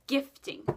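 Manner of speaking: Gifting with a firm falling stress. Retold or imitated. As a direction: lean on Gifting and let it drop. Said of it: "she said it just like that".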